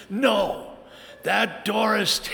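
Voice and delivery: the husband refusing his wife, mock indignant. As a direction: pretend to put your foot down. Shouting at wife in story.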